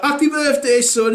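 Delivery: high cheery dad. Retold or imitated. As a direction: cheer him on. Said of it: "my dad were like".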